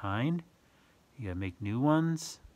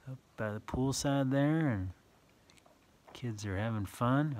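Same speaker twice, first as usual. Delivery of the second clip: usual speaking voice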